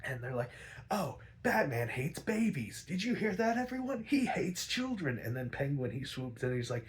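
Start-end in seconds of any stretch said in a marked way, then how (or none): 0.9-5.2 high, scandalised gossip announcing to everyone; mocking crowd. Crowd mocking Batman to everyone, high and scandalised.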